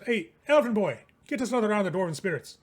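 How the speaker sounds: loud, fast, brash command to the waiter; rude order. Acted. [name] ordering the elven server, loud and brash. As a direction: bark it at the elf like he's staff.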